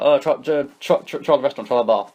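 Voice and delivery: hurried, tripping-over-itself reply; said really quick. Retold or imitated. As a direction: blurt out directions, stumbling over repeats. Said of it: "I just said something really quick".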